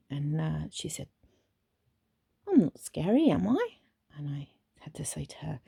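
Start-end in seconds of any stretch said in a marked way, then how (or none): 2.5-3.8 surprised protest. Retold or imitated. Teen startled at being called scary.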